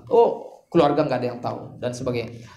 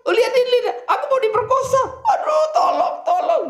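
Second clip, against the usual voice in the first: hammed-up falsetto plea, much higher, breaking into laughter; mock cry for help. Wife pretending to be attacked to accuse Yusuf, hammed-up high scream with laughter. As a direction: overplay a fake victim calling for help, half laughing. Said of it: "tapi sekarang dia teriak".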